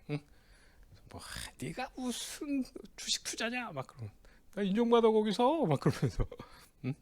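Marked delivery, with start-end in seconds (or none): 1.2-3.7 high, slow, drawn-out mock scoff; playful jeer. Teasing his friend's stock investing, high playful jeer. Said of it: "서로 그렇게 막 장난치듯 얘기하지만". 4.6-5.7 high, louder mock-defensive comeback; playful protest. Friend defending his investing job, playfully protesting. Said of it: "서로 그렇게 막 장난치듯 얘기하지만".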